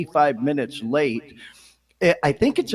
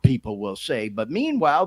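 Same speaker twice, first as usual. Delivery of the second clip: normal talking voice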